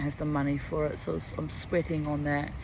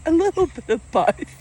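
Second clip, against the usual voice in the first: teasing quip; 12 semitones higher, 7 dB louder than usual. Retold or imitated. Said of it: "And he went".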